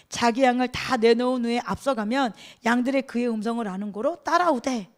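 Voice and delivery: ordinary delivery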